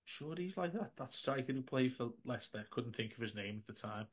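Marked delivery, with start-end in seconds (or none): 0.1-2.7 hushed aside to the mate beside him, much softer; retold whisper. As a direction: lean in and whisper so the man in front won't hear. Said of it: "So I whispered".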